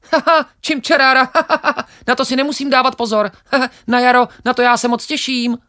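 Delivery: very high, loud, quick chirpy sparrow voice; cheeky sparrow. Acted. Sparrow laughs off the grain, very high and cocky.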